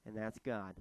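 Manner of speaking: ordinary delivery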